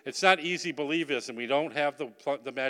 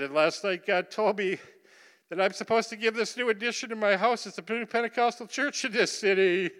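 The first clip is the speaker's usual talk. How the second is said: higher, choked-up voice of a moved mayor; mayor tearful; 8 semitones higher than usual. Retold or imitated. Mayor confessing to the preachers, tearful and much higher. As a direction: let the voice rise and waver near tears. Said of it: "and he starts to cry".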